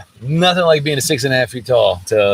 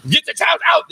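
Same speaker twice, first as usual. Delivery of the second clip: high-pitched mock German order; 14 semitones higher than usual. School staff at car drop-off, mock German command. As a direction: bark it like a caricatured German attendant. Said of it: "They sound German in the morning".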